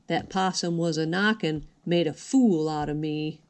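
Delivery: ordinary delivery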